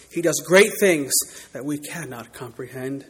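ordinary talk

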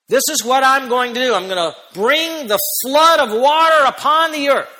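God's voice thundering the judgment; emphatic God. Acted. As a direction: proclaim it as God, full force. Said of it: "how emphatic he is".

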